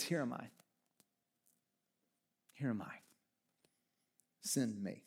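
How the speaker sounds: barely above a breath, humbled; hushed surrender. Retold or imitated. Isaiah offering himself after grace poured out, hushed and low. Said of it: "He says".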